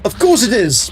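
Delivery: high, sarcastic of course; sarcastic groan of course it is; exasperated. Real, not acted. Exasperated at game quest demand.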